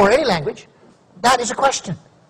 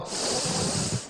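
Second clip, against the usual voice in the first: drawn-out breathy exhale; settling sigh, played up; long theatrical sigh; 13 dB quieter than usual. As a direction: let out a long, slow sigh before answering. Theatrical sigh before answering telemarketer.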